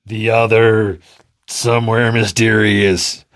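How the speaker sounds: slow, drawn-out eerie answer; mysterious being. Acted. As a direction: stretch it out like an otherworldly visitor.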